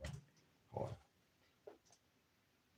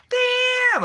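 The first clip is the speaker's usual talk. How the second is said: very high, loud yelp of damn; sudden shrieked damn; 10 dB louder than usual. Real, not acted. Startled yelp while ranting to viewers, shrieking.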